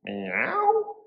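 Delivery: very high, drawn-out meow; little cat. A narrator's reading. Cat answers the little witch, high meowing. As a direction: mew high like a small cat. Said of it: "the little cat said".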